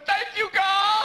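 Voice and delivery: high, slow, shouted thanks to heaven; relieved shout. Retold or imitated. Comic relieved after the first debate, high slow shout. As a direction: cry out to the sky in relief. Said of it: "I was going".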